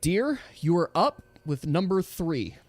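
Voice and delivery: ordinary talk, nothing marked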